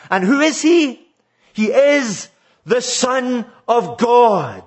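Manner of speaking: high, ringing question, then slow emphatic answer; impassioned preaching. Real, not acted. Preacher declaring who Jesus Christ is, impassioned and emphatic. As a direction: build to a forceful proclamation.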